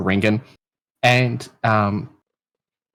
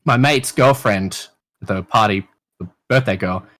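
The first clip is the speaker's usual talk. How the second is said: normal talking voice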